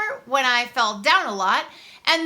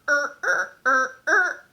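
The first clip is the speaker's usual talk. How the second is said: droning mechanical er-er robot motor sounds; robot noises; 3 dB louder, slower than usual. Retold or imitated. Little Freak stomping around the playground, droning robot noises. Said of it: "making these weird robot noises".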